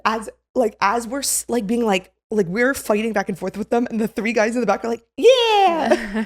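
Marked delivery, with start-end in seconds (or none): 5.2-6.2 high, loud, whooping cheer; imitated cheer. Guys behind backing up the woman, imitated holler.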